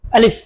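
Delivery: আলিফ said firmly, a strong clear a; a firm demo of আলিফ; firm alif. Shown as a demonstration. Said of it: "শক্ত করে আদায় করতে হবে". (আলিফ শক্ত করে উচ্চারণ)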